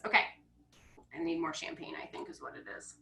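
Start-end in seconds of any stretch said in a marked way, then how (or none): 1.1-2.9 soft, muttered self-joke; a sheepish aside after stumbling; quiet aside. Real, not acted. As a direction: say it under your breath. Embarrassed aside after fumbling.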